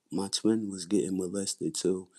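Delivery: ordinary talk, nothing marked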